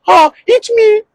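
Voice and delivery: squeaky high mock victim voice; meek elephant. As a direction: play the elephant giving up in a tiny high voice.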